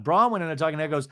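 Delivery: usual speaking voice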